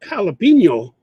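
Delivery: jalapeno with an H sound, "halapeno"; Spanish J as H. Shown as a demonstration. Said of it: "When they see the word".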